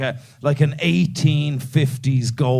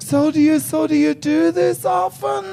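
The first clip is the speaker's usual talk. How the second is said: nervous squeaky small talk; 11 semitones higher than usual. Retold or imitated. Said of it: "I'm like".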